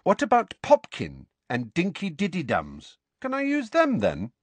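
high, teasing jibe; taunting. A narrator's reading. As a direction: needle him with silly pet names. Harry taunts cousin with pet names.

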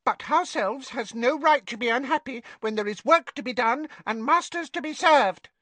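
high, humble elf voice; bow and squeak it politely; house elf. Acted. House elf politely correcting Hermione, high and humble. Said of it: "said the house elf".

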